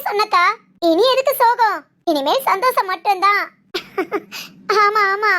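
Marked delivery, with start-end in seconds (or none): 3.7-4.4 quiet, low chuckle; a short happy giggle; soft laugh. Acted. She joins the family's relieved laughter with a soft laugh.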